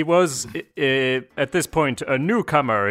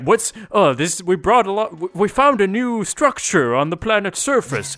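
higher, cheery video game log; oblivious game log voice; 6 semitones higher than usual. Pretend. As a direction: play a clueless game character.

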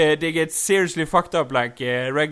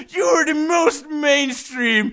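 high, drawn-out, slow yell; mock outraged shout; 11 semitones higher, 4 dB louder, much slower than usual. Trainer accusing Pikachu of being mainstream, mock outraged shout.

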